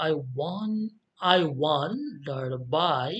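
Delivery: slow scansion, stress on "won" of "wonder" and on "by"; iambic stress. Shown as a demonstration. Teacher showing the beat, slow stress on "won" and "by". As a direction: stretch "won" and "by", keep "I" and "der" light. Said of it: "বাইটা তারপরে stress দেব".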